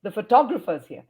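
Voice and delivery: only "tog" and "here" stand out in "photographer's here"; stress on TOG. Shown as a demonstration. Teacher repeating the stressed example, tog up, rest shrunk. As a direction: let "the" shrink and "grapher" fall after "tog". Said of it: "only thing that you should hear is tog here".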